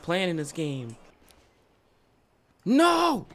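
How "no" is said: high, loud cry of protest; dismayed yell. Real, not acted. Gamer reacting to a mishap, dismayed yell.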